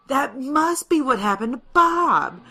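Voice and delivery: high, loud, drawn-out dawning horror; alarmed realisation. Acted. Rebel leader realising what became of a comrade, alarmed. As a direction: let it dawn loudly and slowly.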